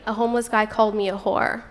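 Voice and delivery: ordinary talk, nothing marked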